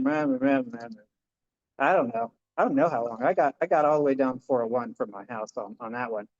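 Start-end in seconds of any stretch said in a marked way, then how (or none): 0.0-1.1 whiny meh meh, last one jumping high and fading; sing-song mock whine; mocking grumble. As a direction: parody your own complaining thoughts. Mocking his own grumbling.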